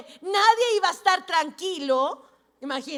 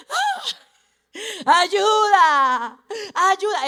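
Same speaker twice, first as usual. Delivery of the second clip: grito de socorro fuerte y alargado; grito de auxilio imitado; 4 semitones higher, 8 dB louder, much slower than usual. Retold or imitated. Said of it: "escucho un grito". (grito actuado de socorro)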